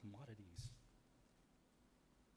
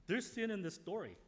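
louder, higher preacher's build to a climax; impassioned sermon climax, voice raised; preaching at full force; 9 semitones higher, 10 dB louder than usual. Acted. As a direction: drive the point home from the pulpit.

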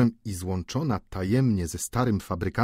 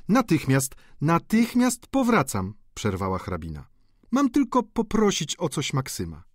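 high, hurried lady's reply; the countess calls back sweetly; wife answering her husband, high voice; 7 semitones higher than usual. A narrator's reading.

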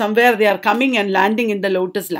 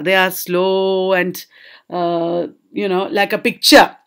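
ordinary talk, nothing marked

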